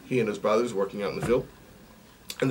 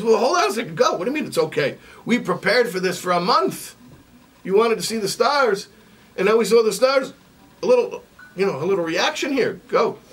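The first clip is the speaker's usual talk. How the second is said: higher, pushy disbelief; incredulous owl; 9 semitones higher, 4 dB louder than usual. Acted. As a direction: owl pressing the goat for a reaction. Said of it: "The owl says".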